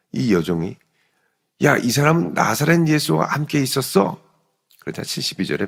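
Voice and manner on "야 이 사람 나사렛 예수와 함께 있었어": higher, louder challenge; accusing. Acted. Servant girl pointing out Peter to bystanders, accusing. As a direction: call someone out in front of others.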